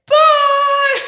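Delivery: very high, loud sing-song bye; a gleeful, shrieked farewell taunt; taunting shout. Retold or imitated. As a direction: holler it out the window while speeding off. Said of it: "put down the window and went".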